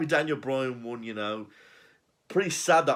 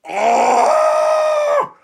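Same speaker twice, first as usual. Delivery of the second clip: huge high mock roar, much louder; imitated roar; 17 dB louder than usual. Big Show ripping chains off the pod, imitated loud roar. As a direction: bellow like a strongman tearing chains. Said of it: "He's like".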